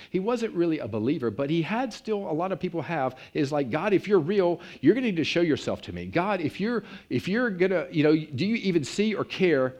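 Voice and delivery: preacher voicing a doubting man's plea; desperate plea. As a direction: cry out to God in doubt. Man's desperate prayer retold.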